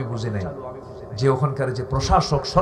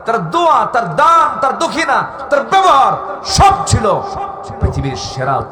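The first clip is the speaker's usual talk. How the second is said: building fervour; 9 semitones higher, 5 dB louder than usual. Real, not acted. Preacher praising the Prophet to the crowd, rising fervent shout. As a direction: list climbing steadily higher and louder.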